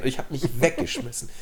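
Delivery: ordinary delivery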